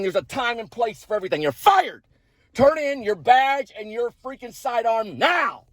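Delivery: high-pitched indignant dressing-down; mad. Pretend. Pretend firing of the officer who stopped him, high angry rant. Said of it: "but I'm mad".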